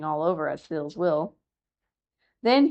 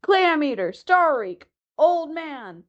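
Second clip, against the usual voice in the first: high jeering yells; yelling; 8 semitones higher, 3 dB louder, slower than usual. A narrator's reading. Seabirds scream insults at the old walrus. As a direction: scream the taunts like a mob of birds. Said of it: "yelling and screaming".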